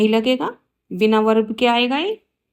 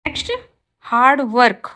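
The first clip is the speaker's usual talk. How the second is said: normal talking voice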